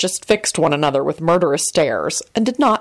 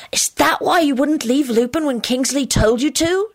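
higher-pitched, probing question; shrewd; 8 semitones higher than usual. A narrator's reading. Timothy questioning George about leaving Lupin, shrewd. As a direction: ask it as if piecing things together. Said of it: "Timothy asked him shrewdly".

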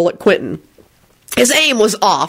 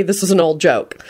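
plain reading voice

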